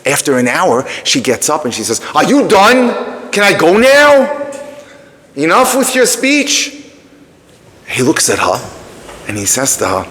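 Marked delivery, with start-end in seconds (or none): 2.1-6.7 higher, slow and exasperated; fed-up. Retold or imitated. Esther walking out on her lecturing date, exasperated. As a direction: drag it out, done with him.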